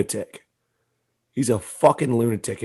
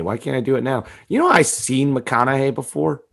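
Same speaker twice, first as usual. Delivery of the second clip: ordinary talk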